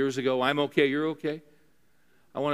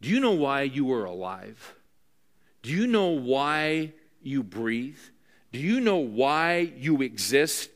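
slow pressing questions; slower than usual. Acted.

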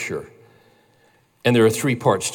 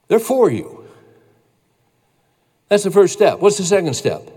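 higher, louder preacher's voice; impassioned preaching; 6 semitones higher, 5 dB louder than usual. Real, not acted. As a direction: preach it with rising conviction. Preacher builds to full force.

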